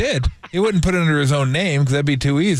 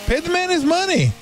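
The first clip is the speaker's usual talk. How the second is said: much higher, hammed-up Russian accent; a mock Russian heavy demanding payment; bad Russian accent; 14 semitones higher than usual. Backing the builder, in a bad Russian accent. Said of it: "A bad Russian accent".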